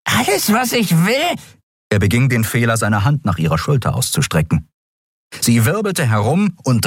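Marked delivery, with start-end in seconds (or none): 0.0-1.4 high, hissed seductive whisper of a voice; hissing. A narrator's reading. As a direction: hiss the word sweetly like a serpent. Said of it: "zischte es".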